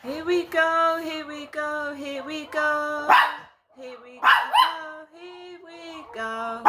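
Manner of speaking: high, slow, drawn-out calling; loud, high refrain, dragging the vowels; drawn-out exclamation. Real, not acted. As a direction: stretch each word out, pitched well up. Singsong reaction as dogs are set off.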